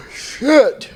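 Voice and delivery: big sigh then high curse; exasperated groan. Real, not acted. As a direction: groan at his own mistake. Realises he took melatonin.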